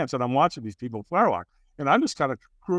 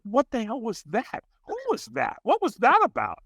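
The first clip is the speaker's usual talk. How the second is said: acted bewilderment; 9 semitones higher than usual. Acting out own baffled reaction.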